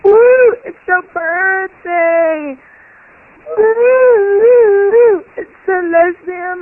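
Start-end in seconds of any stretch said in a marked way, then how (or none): 0.0-0.5 loud, high party whoop; cheering whoop. Real, not acted. Caller cheering the podcast's birthday with a loud whoop. 3.6-5.2 loud, high party whoop; cheering whoop. Real, not acted. Caller cheering the podcast's birthday with a loud whoop.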